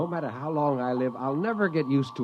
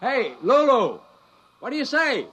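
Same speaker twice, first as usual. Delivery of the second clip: calling out; 12 semitones higher than usual. Acted. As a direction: call out to get the ship to answer. Calling the ship's computer loudly.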